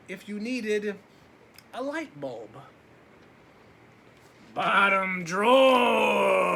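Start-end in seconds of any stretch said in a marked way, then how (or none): 4.5-6.5 slow, loud drawn-out booming dark. Acted. The dark beckons the boy closer, slow and booming. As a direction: stretch it out, huge and looming. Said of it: "said the dark".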